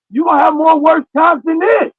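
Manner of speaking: high, loud scolding retort; loud rebuke. Acted. Answering crying jail mates, loud acted rebuke. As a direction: cut through the whining, high and loud.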